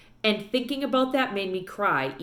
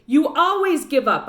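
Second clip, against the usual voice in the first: higher, louder furious shout; angry shout; 7 semitones higher, 7 dB louder than usual. A narrator's reading. Girl shouts at her father for giving up. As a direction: hurl it at him. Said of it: "I shouted".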